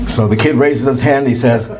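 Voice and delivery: normal talking voice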